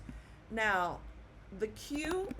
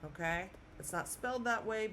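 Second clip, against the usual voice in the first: normal talking voice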